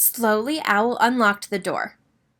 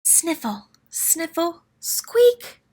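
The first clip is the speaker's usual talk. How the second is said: sniffle sounds; 8 semitones higher, much slower than usual. A narrator's reading. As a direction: sniff like a crying creature. Crying outside door.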